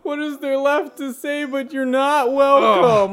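Maui voice. Acted. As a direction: ham up Maui laughing. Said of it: "Maui from Moana".

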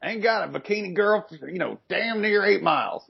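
very high-pitched put-on griping voice; mock complainer. Podcaster imitating a disappointed visitor on an Alaskan beach, mock complaint. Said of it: "you're like".

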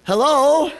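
high, loud singsong hello, rising; nice voice. Retold or imitated. Soul winner calling to the lady inside, in a nice voice. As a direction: call out extra nicely. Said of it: "Nice voice".

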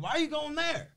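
high, pushy street hustler voice; mock hustler pitch. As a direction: sell it like a slick recruiter. Hustler luring kid at airport.

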